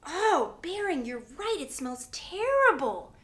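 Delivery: loud high retch easing into appalled agreement; revolted disgust. Acted. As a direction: recoil loudly from a stench. Teacher breathes in a stink.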